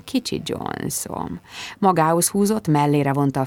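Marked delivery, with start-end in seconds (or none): 0.0-1.4 slow, breathy whisper, no voice; gentle whisper. A narrator's reading. Daniel whispers tenderly to Bridget, soft gentle whisper. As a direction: whisper it tenderly into her ear. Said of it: "súgta gyöngéden".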